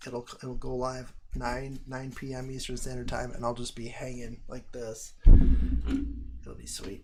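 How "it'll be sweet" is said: breathy whisper, slow; creepy slow whisper. Acted. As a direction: whisper the punchline slowly. Dark joke punchline whispered.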